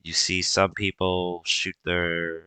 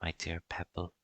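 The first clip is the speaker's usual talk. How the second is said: tender comfort; 7 dB quieter than usual. Acted. GM as mother comforting son.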